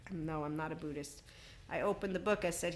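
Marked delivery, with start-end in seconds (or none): 0.1-1.2 whispered denial. Real, not acted. Denying she is a Buddhist, in a whisper. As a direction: whisper "No, I'm not a Buddhist" under the breath.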